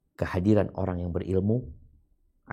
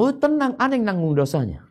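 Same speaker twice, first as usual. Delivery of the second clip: high, loud mock reassurance; mocking the misled; 14 semitones higher, 6 dB louder than usual. Preacher mocking misguided people, high and loud. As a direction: airily brush off the sin, mocking them. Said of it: "sebagian orang mengatakan".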